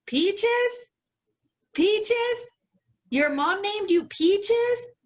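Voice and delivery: mock a baffled old customer mishearing her name; crusty old man. Old customer at the register, high and disbelieving. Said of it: "he's all like".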